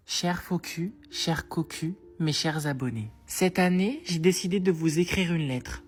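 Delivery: soft, earnest letter read over music; sincere letter reading. Acted. Teen reading a fake sincere letter to followers, softly. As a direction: read it like a heartfelt thank-you note.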